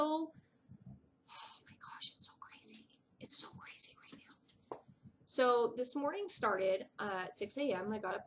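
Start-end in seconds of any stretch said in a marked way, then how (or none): 0.0-4.8 drawn-out whispered oh my gosh; slow, hushed disbelief. Real, not acted. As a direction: whisper it like a secret. Overwhelmed aside to viewers.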